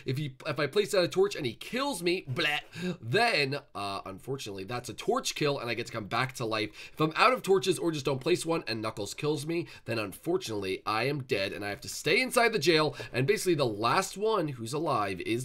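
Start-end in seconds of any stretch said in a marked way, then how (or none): none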